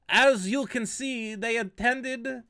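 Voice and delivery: German prosecutor voice, hammed up and failing; failed German accent. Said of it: "I was trying to do a German accent".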